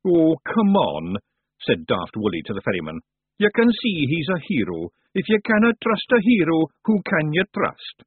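wheedling Scottish character voice; Daft Woolly, pleading Scot. Acted. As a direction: plead the hero's case to the ferryman.

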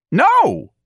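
very high, loud squeaked refusal. A narrator's reading. Office manager refusing elderly employee's retirement, squeaking. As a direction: squeak it out in exasperation. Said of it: "Mister Gonzalez squeaked".

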